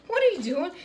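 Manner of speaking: high, loud imitation of an exasperated trainer; mock scolding. Trainer catching a client not breathing, mock scolding. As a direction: call it out in mock alarm.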